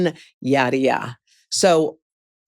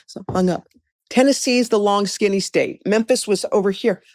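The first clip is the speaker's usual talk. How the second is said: ordinary talk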